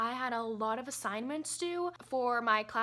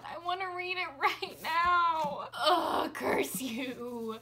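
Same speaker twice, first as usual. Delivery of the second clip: whine; 5 semitones higher, 3 dB louder, much slower than usual. Real, not acted. Whiny about school.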